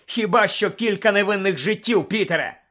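bitter, heated outburst; furious retort. Acted. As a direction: fling the answer back at him angrily. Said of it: "розлючено перепитав Блек".

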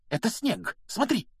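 high-pitched hushed urgency; urgent alarm. A narrator's reading. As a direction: point it out urgently, pitch leaping up. Hermione urgently points out the culprit.

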